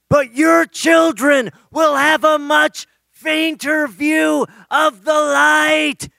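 very high, slow, stretched-out holler; shouting from afar. Retold or imitated. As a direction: call it down a long hallway, dragging each word. Shouting from down the hallway.

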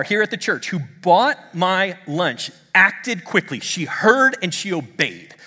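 high, driving preacher delivery; impassioned preaching. Real, not acted. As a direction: press each phrase on the congregation. Preaching at full force.

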